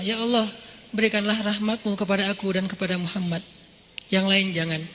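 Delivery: soft, low supplication; quiet prayer. Retold or imitated. New convert praying on his camel, quietly. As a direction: murmur a humble private prayer.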